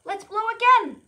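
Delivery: loud high cue to blow; called out. Shown as a demonstration. Teacher rallying the class to blow, loud. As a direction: shout it out for the kids. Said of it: "leads class to blow".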